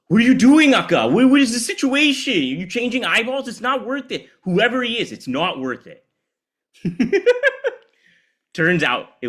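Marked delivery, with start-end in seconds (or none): 0.1-6.1 put-on high, loud alarmed kid voice; little brother pleading with his sister to stop; panicked child. Child shouting at sister with curler. 6.8-7.7 squeaky high giggle; high laugh. Real, not acted. Laughs at own childhood memory.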